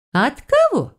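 high, needling question; sarcastic reptile, pitch leaping up; snide. A narrator's reading. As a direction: ask with mocking disbelief. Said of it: "продолжала ехидничать рептилия".

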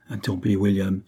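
usual speaking voice

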